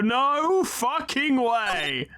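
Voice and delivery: high, slow, drawn-out gleeful refusal; defiant refusal. Acted. Improvising the defendant's rude refusal to the lawyers. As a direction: stretch it out, rubbing it in.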